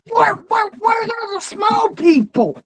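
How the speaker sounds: hollers for the short folks in jest; mock shout. Retold mock search for short people.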